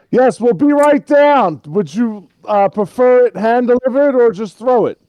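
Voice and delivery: high, very loud call down; shouted reply. Acted. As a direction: yell with exaggerated cooperation. Shouts fake compliance to the villain.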